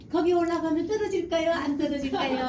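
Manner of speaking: put-on high singsong parent quizzing a child; 아이 어르는 부모. Mimics a too-friendly parent asking child. (높은 톤으로 아이 어르는 말투 흉내)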